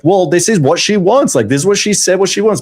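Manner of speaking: a hint of a guy thinking it through logically. Guy reasoning out what a woman wants, lightly mock logical. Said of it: "very logically, you're like".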